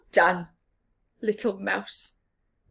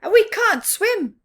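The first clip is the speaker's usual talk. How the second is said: fox voice; 11 semitones higher, 11 dB louder than usual. Acted. Fox complains in story.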